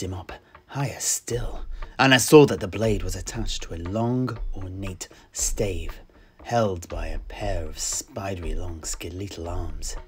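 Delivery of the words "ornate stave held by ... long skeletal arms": plain reading voice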